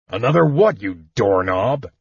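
a touch of gruff exasperation; exasperated dwarf. A narrator's reading. Dwarf loses patience with the sobbing kender, exasperated. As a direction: snap at him like a fed-up old dwarf. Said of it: "Flint said in exasperation".

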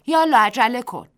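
loud, high call to hurry; urgent shout. Acted. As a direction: shout at her friend to hurry. Mrs Delacroix urges her friend.

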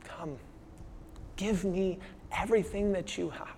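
hushed, inviting the listeners to come; a soft, earnest call to give everything; solemn Jesus invitation. Retold or imitated. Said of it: "Jesus here this morning is saying".

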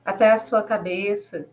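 slow, measured meditation voice with long pauses; guided meditation. Acted. Guide leading listeners to breathe and relax, slow guided-meditation voice. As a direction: guide the listener gently through breathing, drawing words out.

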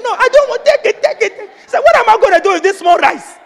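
high, loud refusal broken by laughs; laughing refusal. Retold or imitated.

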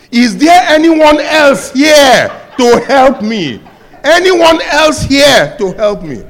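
high, loud, laughing plea for someone else; hammed-up desperate cry, half laughing; frantic shout. Man refuses to let go.

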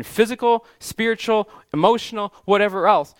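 preacher lifting the list up high; rising fervour. Real, not acted. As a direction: climb on each item of the list.

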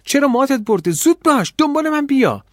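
very high, loud urgent orders; yelling. A narrator's reading. Girl hurries the stunned boy away, yelling. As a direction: shout someone out of a daze. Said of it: "اما سرم داد زد".

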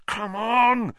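high, urgent push on the broom; growled urging. Acted. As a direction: drive the broom on through clenched effort. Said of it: "he growled at his nimbus".

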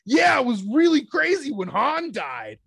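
put-on high, slow, guileless child; innocent kid voice. Kid spoiling the movie to the cashier, high and innocent. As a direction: spoil it sweetly like a clueless kid. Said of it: "He just looks at me and he goes".

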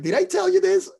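high mock forgetful voice; playing my forgetful self, pitched high. Imitating own forgetful self.

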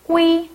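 꾸이 on a high, level tone; model pronunciation. Shown as a demonstration. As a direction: say 꾸이 high with a tense k. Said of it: "베트남어로는 꾸이라고".

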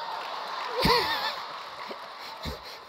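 shrill breathy burst of laughter; high falsetto cackle. Real, not acted. Preacher cracking up at his own story, high laugh.

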